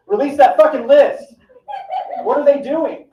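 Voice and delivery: indignant shouted demand; mock rant. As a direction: shout at the powers that be. Ranting demand.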